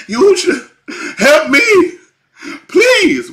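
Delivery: high, loud mock pleading holler; hammed-up desperate yell for help. Believer hollering at Yahusha for help, mock loud plea. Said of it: "you ain't hollering at him like".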